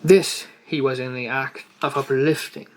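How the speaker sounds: ordinary delivery, nothing marked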